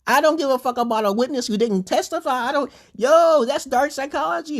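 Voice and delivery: high, heated retelling of his own rant; retold outburst. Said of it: "I said".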